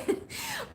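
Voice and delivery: a short high giggle; little laugh at the memory. Real, not acted. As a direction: giggle mid-story. Laughing while telling the story.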